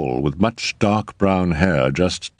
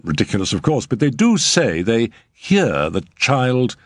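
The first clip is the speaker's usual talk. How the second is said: higher, eager nervous agent; hasty reassurance; 6 semitones higher than usual. A narrator's reading. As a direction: rush to smooth it over. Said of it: "Mister Radish hastened to assure her".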